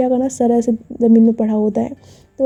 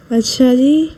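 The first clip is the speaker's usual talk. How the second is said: plain reading voice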